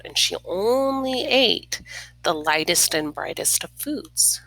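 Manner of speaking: plain reading voice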